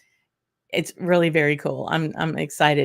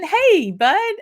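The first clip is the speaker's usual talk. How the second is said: higher and louder happy hello; excited greeting; 10 semitones higher, 7 dB louder than usual. Real, not acted. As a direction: greet a buddy with a burst of joy.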